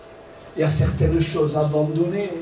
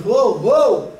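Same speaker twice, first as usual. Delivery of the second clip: sudden loud startled oh; startled cry; 5 semitones higher, 6 dB louder than usual. Retold or imitated. Pastor startled by the church member's lie, loud shocked oh. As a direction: jolt out a shocked oh.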